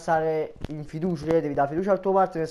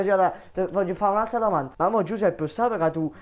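normal talking voice

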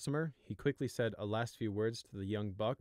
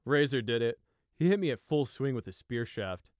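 casual; 3 semitones higher, 7 dB louder than usual. Acted. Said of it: "he said casually".

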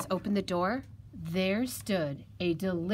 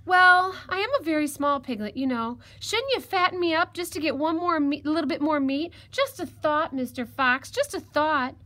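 high, louder little piglet voice; crafty piglet, coaxing; 7 semitones higher, 6 dB louder than usual. Acted. Piglet talks the fox into a bath, coaxing. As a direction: suggest it innocently in a high piglet voice.

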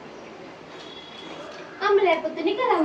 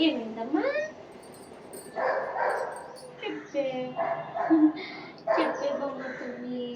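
soft coaxing; 3 semitones lower, 7 dB quieter than usual. Real, not acted. Mother softly coaxing her crying daughter to speak.

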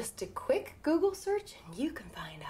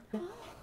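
gasp; 13 semitones higher, 6 dB quieter than usual. Acted. Gasp at ghost story.

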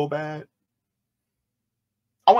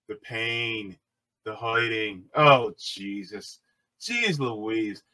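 much lower and softer, exaggerated feeble wail; hammed-up fake distress; 7 semitones lower, 3 dB quieter, slower than usual. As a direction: overact a fake victim, limp and pleading. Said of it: "she can't even act like she's in trouble".

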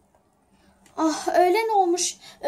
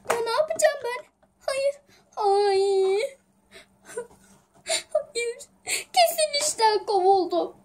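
high, tearful wail over lost papers; crying in panic; 6 semitones higher than usual. Acted. Raven finds the documents missing, crying. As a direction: sob it out, voice pitched up in despair. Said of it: "Neden ağlıyorsun canım?".

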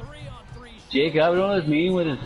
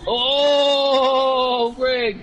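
excited shout; 10 semitones higher, 4 dB louder than usual. Real, not acted. Excited exclamation.

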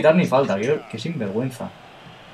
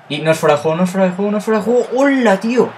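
repeats climbing higher each time into a loud shout; building excitement; 8 semitones higher, 5 dB louder, faster than usual. Real, not acted. Gamer hoping it is not offside, climbing excited shout. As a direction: rise on every repeat until it bursts.

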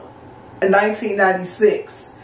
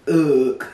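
disgusted groan; 6 semitones lower than usual. Retold or imitated. Looking at him in disgust.

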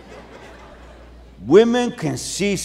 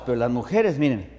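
ordinary delivery

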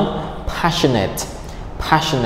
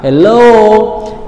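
hello lifted high at the end; rising tone on hello; 8 semitones higher, 4 dB louder than usual. Shown as a demonstration. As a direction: let hello climb well above normal pitch. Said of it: "So the tone goes up".

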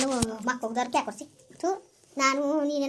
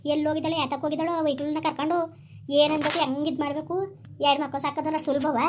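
usual speaking voice

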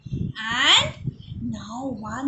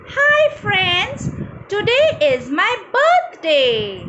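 put-on cheerful higher-pitched birthday child; Ria; 5 semitones higher, 4 dB louder than usual. Ria greets the children on her birthday, higher character voice. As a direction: greet friends in a higher, louder storybook voice. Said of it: "today is Ria's birthday".

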